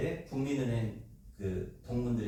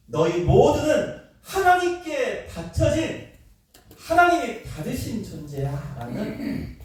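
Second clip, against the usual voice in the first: preaching declaration; 8 semitones higher, 5 dB louder, slower than usual. Acted. Paul declaring to Corinthians.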